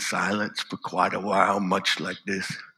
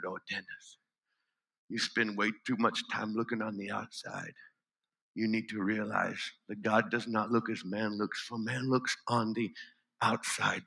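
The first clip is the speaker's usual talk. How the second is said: hushed, tender comfort, much softer; soft and gentle; 10 dB quieter than usual. Acted. Stacy comforting the crippled boy, hushed and gentle.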